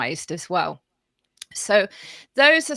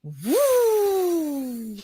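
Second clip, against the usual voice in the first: a long higher woo gliding down; voiced woo; 7 semitones higher than usual. Shown as a demonstration. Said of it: "we're going to do some voiced sounds".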